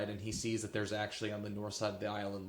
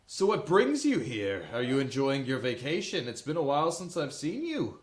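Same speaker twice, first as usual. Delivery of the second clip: a hearty captain, higher and louder; jovial captain, a bit higher; 4 semitones higher, 6 dB louder than usual. Acted. Captain Gunther questioning the returning group, cheerful higher voice. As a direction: ask about their trip cheerfully.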